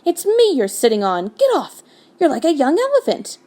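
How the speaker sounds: high-pitched, indignant squawk; angry voice. A narrator's reading. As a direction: protest in comic exasperation. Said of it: "came an angry voice".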